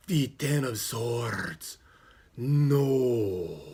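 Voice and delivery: slow, drawn-out fortune-teller dread; dramatic psychic. Acted. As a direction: draw out each word ominously. Voices the psychic in story.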